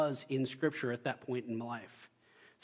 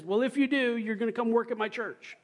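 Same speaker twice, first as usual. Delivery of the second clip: phone yell; 7 semitones higher than usual. Retold or imitated. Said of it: "he kinda yells when he's on the phone".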